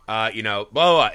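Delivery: normal talking voice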